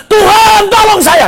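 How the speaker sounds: high, loud cry for help; Petrus. Acted. Drowning disciple calling to God for rescue, urgent shout. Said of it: "Sama aja seperti Petrus lagi tenggelam".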